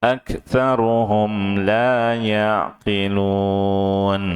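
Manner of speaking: slow, drawn-out melodic tilawah; measured Quranic chanting, slow and sustained; Quran recitation. Shown as a demonstration. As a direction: chant each word out slowly for the class to follow. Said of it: "Ikuti saya".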